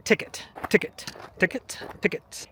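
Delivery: ticket said the French way, as ti-KAY, ti-KAY, softly; French accent. Shown as a demonstration. Teaching viewers French words, with a French accent. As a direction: repeat ticket like a French local. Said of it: "You gotta get that accent in there".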